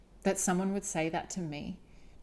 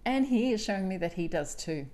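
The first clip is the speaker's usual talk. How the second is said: ordinary delivery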